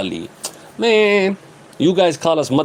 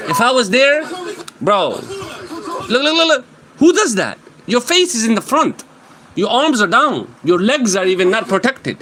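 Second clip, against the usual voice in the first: exasperated outburst; 7 semitones higher than usual. Real, not acted. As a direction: yell at the screen in disbelief. Yelling at a fighter on video.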